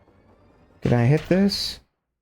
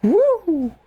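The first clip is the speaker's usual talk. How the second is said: high delighted woo; excited whoop. Real, not acted. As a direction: whoop it out, thrilled.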